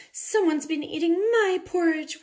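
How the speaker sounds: high, loud wail; wailing Mama Bear. A narrator's reading. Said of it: "wailed Mama Bear".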